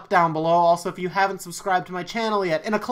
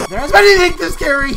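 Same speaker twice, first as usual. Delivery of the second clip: high, loud cry mid-sentence; frightened shout; 10 semitones higher, 10 dB louder, faster than usual. Real, not acted. Streamer cut off by a jump scare, shouting high. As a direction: yell it as the scare lands.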